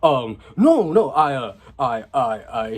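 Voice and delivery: imitated embarrassed backpedalling; flustered stammer. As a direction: stammer as if caught out, losing steam. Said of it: "she just cuts him off".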